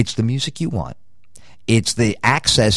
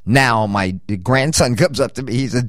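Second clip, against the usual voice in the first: usual speaking voice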